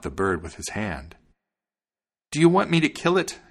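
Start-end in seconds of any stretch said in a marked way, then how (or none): 2.3-3.4 high, raised voice of the wife; wife asking. A narrator's reading. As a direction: ask it high, as the wagoner's wife. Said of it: "his wife asked".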